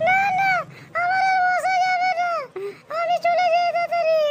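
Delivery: frightened wife pleading with her lover; panicky wife voice. Acted. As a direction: play the caught-out wife, high and fretting. Wife voice again.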